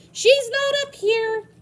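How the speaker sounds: high, loud, slow shout up the stairs; a boy's high call from the bedroom doorway. Acted. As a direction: yell it through the house, calling for her.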